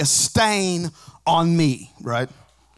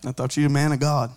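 ordinary talk, nothing marked

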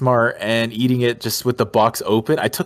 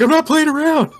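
panicked shout; 5 dB louder than usual. Retold or imitated. Panicking over collapsing cookie.

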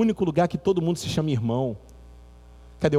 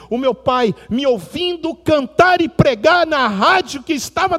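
higher, louder sermon testimony; impassioned preaching; 6 semitones higher, 5 dB louder than usual. Acted. Pastor retelling the singer's testimony to the church, impassioned preaching. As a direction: preach the story with rising fervor.

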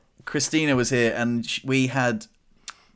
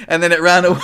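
laughing; 5 semitones higher, 9 dB louder, faster than usual. Real, not acted. Still laughing telling story.

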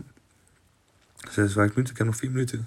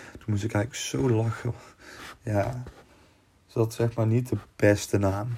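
normal talking voice

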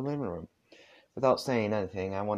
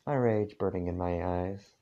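ordinary delivery, nothing marked

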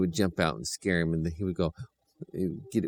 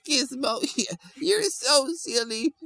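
much higher and slow, fond; sweet children's voice; slower than usual. A narrator's reading. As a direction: coo at the puppy like a small child. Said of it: "And he was like".

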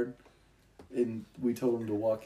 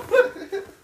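very high, loud, wailing laugh; helpless high-pitched laughing fit; 7 dB louder than usual. Real, not acted. Friend after his rusty hook joke, laughing.

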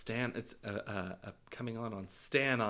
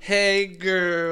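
high, loud, drawn-out mock hello; hammed-up greeting; 9 semitones higher, 11 dB louder than usual. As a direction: call out to the girls, playfully over the top. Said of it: "I'll be like".

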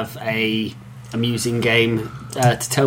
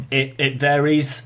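ordinary talk

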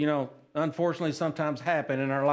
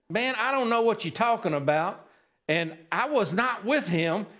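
higher-pitched, acted protest; insistent denial; 7 semitones higher than usual. Peter denying he was with him, insistent protest.

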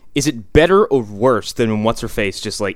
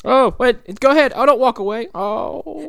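put-on much higher, whiny sleazy voice calling after girls; creepy pleading; 14 semitones higher than usual. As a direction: beg them not to leave, creepily. Voices creepy guy offering popcorn.

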